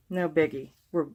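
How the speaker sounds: ordinary talk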